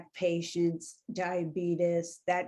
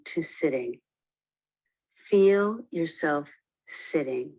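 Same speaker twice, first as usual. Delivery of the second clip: guided meditation; 4 dB louder than usual. Acted. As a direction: lead them into stillness, unhurried. Leads a guided awareness exercise.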